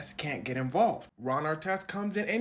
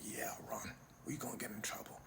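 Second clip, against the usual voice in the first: soft whispered warning; whispered reply; 8 semitones lower, 16 dB quieter, faster than usual. Retold or imitated. Teammate telling Ron in the locker room, whispered. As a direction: whisper the bad news.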